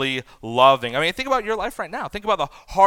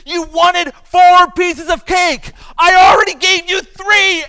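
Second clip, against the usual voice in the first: loud, high-pitched exploding indignation; hammed-up furious dad tirade; outraged inner rant; 12 semitones higher, 8 dB louder than usual. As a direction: erupt at the child like a geyser of anger. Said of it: "huge geyser of anger just steaming up".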